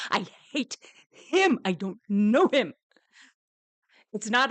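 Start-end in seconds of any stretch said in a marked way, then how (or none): 0.1-2.7 slow emphatic hate. Real, not acted. Venting about Stefan, slow loud hate. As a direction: lean on each word in disgust.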